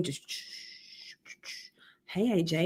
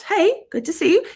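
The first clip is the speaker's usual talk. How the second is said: normal talking voice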